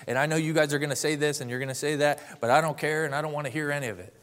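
rapid mock-angry brush-off; retold angry dismissal. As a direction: cut them off like an irritated man. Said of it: "to get very angry".

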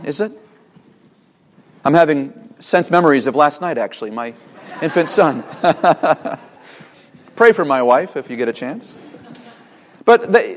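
ordinary talk, nothing marked

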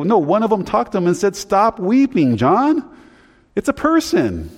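very high, insistent protest; retold emphatic correction; exasperated rebuttal. Acted rebuttal quoting the elder.

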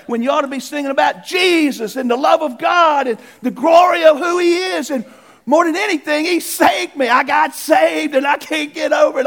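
loud, high-pitched revival preaching at full force; fervent preaching. Acted. Pastor rousing his church on Sunday, loud fervent preaching. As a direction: preach it out to the congregation with all your fire.

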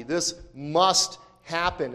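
"must" stretched out slowly; slow, weighty stress on "must"; emphatic must. Real, not acted. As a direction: land firmly on "must", taking your time. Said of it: "as I've been emphasizing so far".

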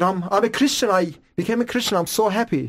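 high-pitched, eager happy announcement; retold happiness. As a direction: reenact joyfully insisting to mom.

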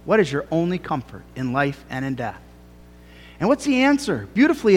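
ordinary talk, nothing marked

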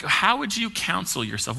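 ordinary talk, nothing marked

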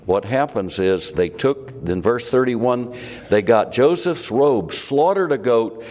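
ordinary talk, nothing marked

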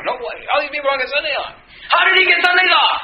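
high-pitched mock outrage, building to a shout; upset coworkers. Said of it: "they were all upset about this".

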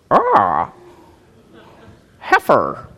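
a hint of a cawing crow; lightly voiced cawing crow. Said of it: "this crow comes down and says".

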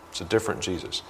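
quietly repeating it in dismay. Real, not acted. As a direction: let it drop to a hush, half to yourself. Quiet repeat of the same line.

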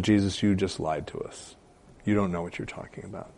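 softly voiced imagined quote; understated accusation. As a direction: drop the volume and accuse quietly. Voicing disciples' imagined accusation, softly.

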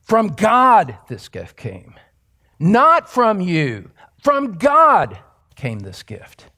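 slow, punched "From God" against "Not from you"; stress on origin. Shown as a demonstration. Preacher contrasts where the gift came from, heavily stressed. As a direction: hit "God" and "Not" hard, drawing them out. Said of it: "his emphasis is on where the gift came from".

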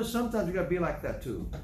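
ordinary talk

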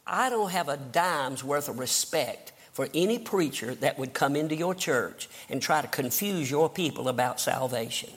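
usual speaking voice

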